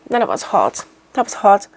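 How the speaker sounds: holding back tears; emotional. Real, not acted. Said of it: "before I get really emotional".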